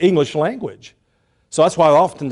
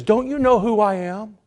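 raised-pitch rebuking question; mild rebuke; 6 semitones higher than usual. Retold or imitated. Gabriel rebuking doubting Zacharias, mildly indignant. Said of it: "you can tell that this is a rebuke".

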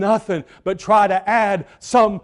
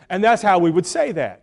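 ordinary delivery

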